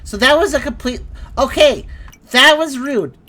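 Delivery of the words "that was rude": indignant. Real, not acted. Startled by jumpscare.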